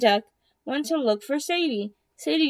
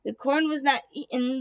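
plain reading voice

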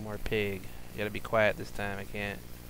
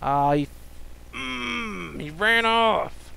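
high, slow whining complaint; whiny groan; 10 semitones higher, 6 dB louder, much slower than usual. Real, not acted. Out-of-breath gamer whining that the pig ran off. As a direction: whine it out in defeat.

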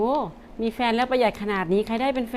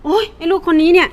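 exasperated scolding; 3 semitones higher, 8 dB louder than usual. Acted. Mother fed up scolding child.

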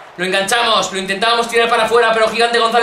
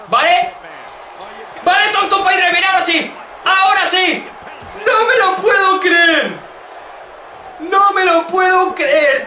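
excited disbelief; 8 semitones higher than usual. Real, not acted. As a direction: yell it, unable to believe it. Another kick-out surprises him.

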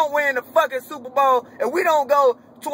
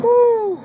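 high, loud frustrated "woo"; exasperated whoop; 11 semitones higher, 6 dB louder than usual. Real, not acted. Fan groaning about the thirtieth pick: loud, high whoop.

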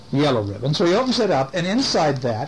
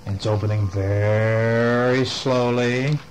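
slow, lower storytelling; slow suspense; 4 semitones lower, slower than usual. A narrator's reading. Storyteller tells of the door creeping open, suspenseful. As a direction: draw it out to build suspense.

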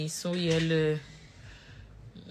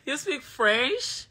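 slow, higher, drawn-out amused question; amused teasing; 7 semitones higher, much slower than usual. Real, not acted. Mother teasing her son about speaking French, drawn out.

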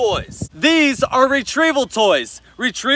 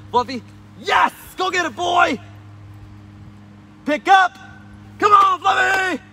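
calling out to dog; 6 semitones higher than usual. Real, not acted. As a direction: shout the dog over, high and eager. Calling dog to fetch weighted toy.

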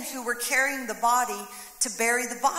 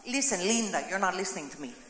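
sinking low and softer on each word; viral Linda video; 6 semitones lower, 5 dB quieter, faster than usual. Retold or imitated. Preacher quoting the viral video to the church, sinking low exasperated voice. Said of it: "You've seen that video".

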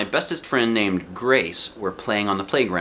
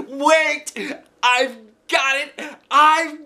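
high, loud, thrilled shouting; Polly shouting his idea, bouncing; excited shout; 4 dB louder, much slower than usual. A narrator's reading. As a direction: burst with a great idea. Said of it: "he shouted, real excited".

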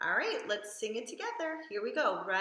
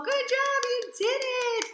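excited praise; 14 semitones higher, 7 dB louder than usual. Real, not acted. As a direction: cheer them on. Praising the children.

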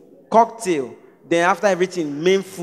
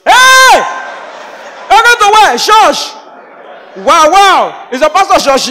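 high, loud shocked outburst; mock astonishment; 7 semitones higher, 5 dB louder, slower than usual. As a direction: shout it in comic disbelief. Mock shock at hearing "church.

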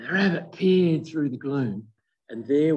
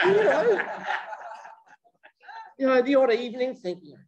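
high, teasing reassurance; lightly mock-soothing, up high; playful conspiratorial aside; 9 semitones higher than usual. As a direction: coax them like sharing a naughty secret. Conspiratorial aside about voodoo dolls.

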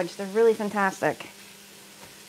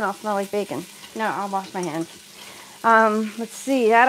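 usual speaking voice

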